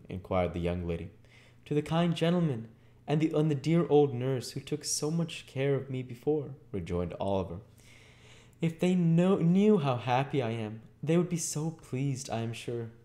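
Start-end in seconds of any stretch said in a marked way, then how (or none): 1.6-6.5 put-on high, sincere child voice; earnest little boy. Voices Oliver in the story. 8.6-12.9 lightly voiced, high earnest boy; grateful little boy. Voices Oliver in the story.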